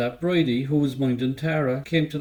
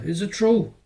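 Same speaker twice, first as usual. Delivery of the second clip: shocked; 6 semitones higher, 3 dB louder than usual. Acted. Boy asks Tara about abuse.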